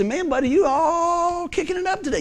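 high-pitched mock listener voice; teasing listener. Churchgoer ribbing the fired-up preacher, high put-on voice.

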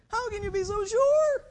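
high-pitched sceptical challenge; mock doubting. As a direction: push the question up high, doubting. Mimics doubt's challenging question.

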